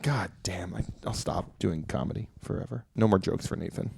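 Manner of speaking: hushed, defeated near-whisper; mock dejection. Comedian swearing off comedy after a predictable joke, hushed mock dejection. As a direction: say it very softly, as if giving up.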